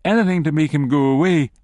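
usual speaking voice

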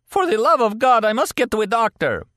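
very high, loud desperate begging; agonized plea. A narrator's reading. Injured captive pleading with interrogator for help, agonized. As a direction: beg for a doctor through the pain.

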